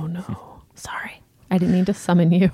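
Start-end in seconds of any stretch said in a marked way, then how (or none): 0.0-1.2 hushed, unvoiced "oh, no"; hushed so Alexa won't wake; whisper. Real, not acted. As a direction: whisper so the speaker won't hear. Afraid of summoning Alexa.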